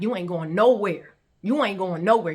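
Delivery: loud, emphatic order; forceful threat. Retold or imitated. Telling him he is locked in, forceful. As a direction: hit both repeats hard at full volume.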